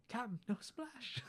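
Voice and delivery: a baffled pirate quietly reporting to his captain; hushed pirate. Retold or imitated. Pirate reporting to Hook, high, soft and slow. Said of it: "He's like".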